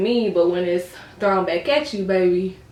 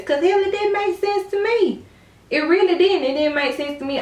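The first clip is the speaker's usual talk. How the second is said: incredulous protest; 10 semitones higher than usual. Real, not acted. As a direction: voice jumping way up high. Protesting disbelief at the story.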